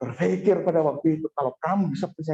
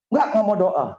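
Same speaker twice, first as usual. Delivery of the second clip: imitating wife refusing; 5 semitones higher, 6 dB louder than usual. Wife's refusal acted in story.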